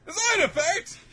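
very high, loud rising squeal; mock shocked echo. Attackers echoing Murakami's explanation, mock shocked squeal. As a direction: squeal it back, hammed-up disbelief.

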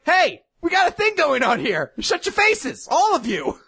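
hammed-up snake lady bossing around; bossy Melascula. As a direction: shout down the whole room. Melascula silences everyone.

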